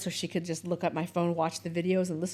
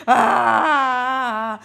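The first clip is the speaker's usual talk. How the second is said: loud mock cry into an iPad; imitated child's crying wail; 4 semitones higher, 9 dB louder than usual. Mother imitating her daughter crying into the iPad, a loud mock wail. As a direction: wail like a sobbing kid.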